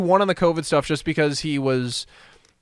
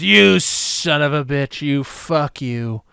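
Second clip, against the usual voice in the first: slow, deliberately spaced mock insult; imagined Trump insult; much slower than usual. Trump swearing at Biden on the debate stage, slow mock insult. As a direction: spell out each word of the pretend swearing slowly. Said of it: "Trump came out and just goes".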